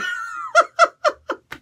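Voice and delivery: shrill explosive laugh; high laugh burst. Real, not acted. Reviewer cracking up at his own joke, shrill laugh.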